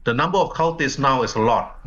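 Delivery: ordinary talk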